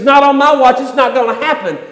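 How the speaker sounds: high, loud defiant declaration; loud refusal. Retold or imitated. Peter loudly vowing to protect Jesus, defiant. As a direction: plant your feet and refuse.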